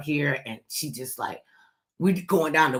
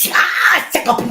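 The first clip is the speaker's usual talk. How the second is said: mock crying wail; 13 semitones higher, 10 dB louder, slower than usual. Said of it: "crying and going".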